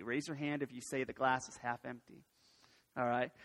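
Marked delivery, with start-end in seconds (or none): 1.0-2.2 low, soft and deflated; downbeat half empty. Shown as a demonstration. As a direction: let it sink down low. Polls the room for pessimists.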